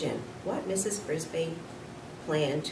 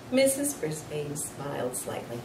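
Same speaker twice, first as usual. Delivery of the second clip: ordinary delivery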